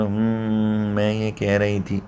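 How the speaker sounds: mock nasal hum into the words; nasal girlish tone. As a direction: imitate a girl talking through the nose. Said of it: "नाक से बोलने लगे". (लड़की जैसी नाक से बोलने की नकल)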